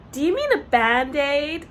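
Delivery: put-on a light American accent, slowed and rising into a question. American flight attendant correcting the British girl, light American accent. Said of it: "in an American accent".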